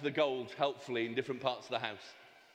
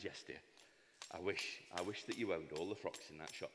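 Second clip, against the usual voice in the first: low, much softer meek mumble; browbeaten husband; 9 semitones lower, 10 dB quieter than usual. Acted. Browbeaten husband humouring his wife, low and meek. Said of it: "who's pretty browbeaten".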